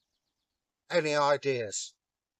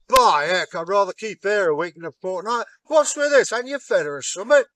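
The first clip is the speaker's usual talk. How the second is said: high, loud, fast amazement; the giant, amazed at how much the bride eats, high and loud; astonished giant; 5 semitones higher, 8 dB louder, faster than usual. Acted. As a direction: blurt it out, gobsmacked.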